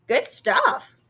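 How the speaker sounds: muffled words round a bite of pie; dad mumbling with his mouth full of pie; mumbled through a mouthful. A narrator's reading. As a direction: talk past a mouthful, pleased. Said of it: "he mumbled through a mouthful".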